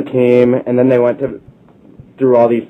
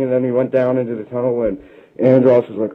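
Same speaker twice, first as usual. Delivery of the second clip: normal talking voice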